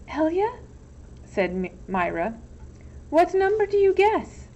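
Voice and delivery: put-on high, airy empty-headed girl voice; Myra dithering. A narrator's reading. Myra unsure which suitor to pick, high dithering voice. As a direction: play her high and dim.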